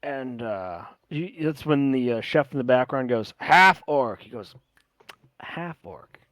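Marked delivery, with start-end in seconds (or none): 3.4-4.2 loud chef's shout from the kitchen; calling out. Acted. Chef calls out from the back. 5.4-6.2 hushed, half-whispered echo; quiet repeat. Acted. Quiet repeat after the shout.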